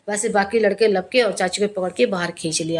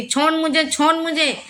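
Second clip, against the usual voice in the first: a higher, nasal, menacing threat from a possessed woman; an aunt possessed in a pit, nasal and higher, threatening her rescuer; 5 semitones higher than usual. Retold or imitated. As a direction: threaten through the nose like something inside her is speaking. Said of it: "नाक से उनकी आवाज़ आती थी".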